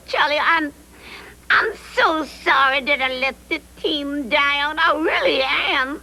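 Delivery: high, whimpering apology; tearful apology. Acted. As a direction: plead forgiveness, close to tears. Tearful apology for failing team.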